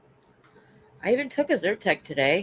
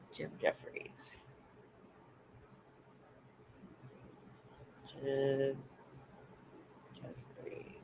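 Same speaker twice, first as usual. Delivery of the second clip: whispering to herself; 3 semitones lower, 20 dB quieter, much slower than usual. Real, not acted. Muttering name while searching online.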